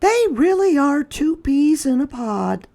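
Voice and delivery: high, quick motherly voice; a mother fondly teasing her son; mother's voice. A narrator's reading. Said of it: "said Chester's mother".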